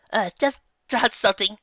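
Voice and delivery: nervous filly excuse; too-loud exclaim. Acted. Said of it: "exclaimed too loudly".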